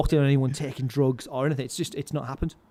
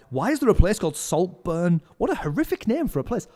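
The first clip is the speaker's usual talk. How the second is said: higher-pitched retold disbelief; mock incredulity; 5 semitones higher, 4 dB louder than usual. Comedian remarks on a local place name, mock appalled. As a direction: act out a comedian baffled by a silly name.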